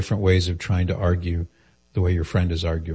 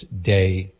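ordinary talk

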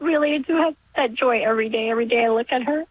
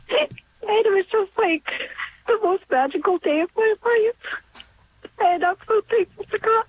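crying with joy, voice pitched up; tearful, emotional; 5 semitones higher than usual. Real, not acted. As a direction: overcome with grateful tears. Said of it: "I wasn't expecting to get so emotional".

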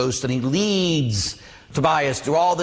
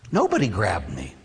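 sober reveal; 8 semitones lower than usual. Real, not acted. Hushed aftermath in dramatic story.

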